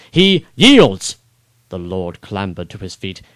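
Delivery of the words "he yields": high loud insistence; shout. Acted. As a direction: shout it so all hear. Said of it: "I shouted at him".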